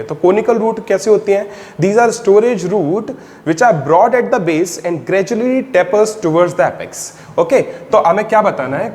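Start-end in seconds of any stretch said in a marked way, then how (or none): 1.8-7.1 slow, deliberate note-taking pace; slow dictation. Shown as a demonstration. Teacher dictating a line for students to copy, slowly. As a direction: read it out so they can write it down.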